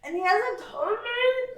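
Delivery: high, drawn-out mock disbelief; exaggerated offended question; mock indignation. Mock-hurt at being left out.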